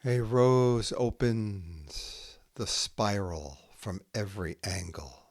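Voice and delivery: slow, measured haiku reading with long pauses between poems; poetry reading. Acted. Poet reading her own haiku to listeners, slow and measured. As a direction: let each image land before the next.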